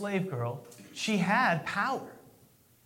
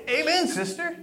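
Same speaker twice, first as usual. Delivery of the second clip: mock amen; 9 semitones higher than usual. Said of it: "And you want to say".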